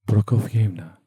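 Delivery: lightly strained, struggling for breath; wheezing repetition. A narrator's reading. Choked captive repeats the prompted name, slightly wheezing. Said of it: "diye tekrarladı hırıldayarak nefes almaya çalışan".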